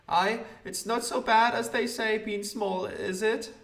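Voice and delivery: high piping lad's voice; the newsboy answers the small man, high and piping. Acted. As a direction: chirp it like a cheeky small boy. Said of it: "I'm Little Rob".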